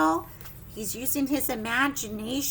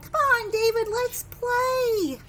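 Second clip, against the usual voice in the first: put-on friendly kids; 8 semitones higher, 3 dB louder, slower than usual. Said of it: "They're like".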